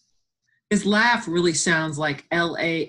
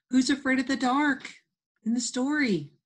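questioning; 8 semitones higher than usual. Real, not acted. Teacher quizzing kids, sing-song.

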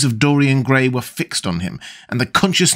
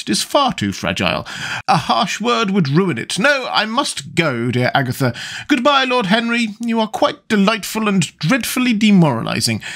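a hint of a fussy older lady, higher; lightly voiced chattering duchess; 8 semitones higher than usual. The Duchess confiding to Lord Henry, lightly voiced and fussy. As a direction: prattle on like a flustered duchess.

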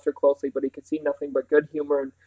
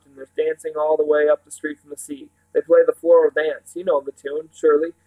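louder, lively country housekeeper; enthusiastic Mrs Park; 5 dB louder than usual. Acted. Housekeeper tells children about carnival day, eagerly. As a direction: tell it eagerly in a rustic voice.

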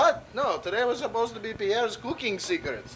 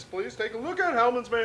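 lightly put-on commercial voice; ad announcer voice. Chef slips into a hammed-up mayonnaise commercial voice. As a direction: pitch the product like a TV ad. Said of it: "I'm out of character".

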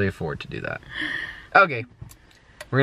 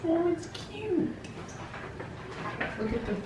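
cooing; 12 dB quieter than usual. Real, not acted. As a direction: coo over it like a puppy. Sees the room again.

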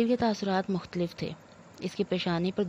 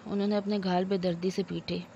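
plain reading voice

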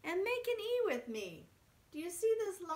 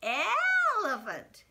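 slow drawn-out word; 6 semitones higher, 6 dB louder, much slower than usual. Shown as a demonstration. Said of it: "What about".

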